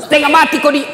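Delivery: loud, high scolding; angry wife. Retold or imitated. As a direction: scold the husband hard. (istri marah memarahi suami camat)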